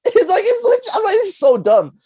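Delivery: high, loud, through laughter; laughing exasperation. Real, not acted. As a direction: burst out laughing while ridiculing it. Cracking up at the absurd twists.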